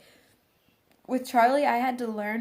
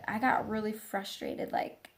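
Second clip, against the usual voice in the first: ordinary talk, nothing marked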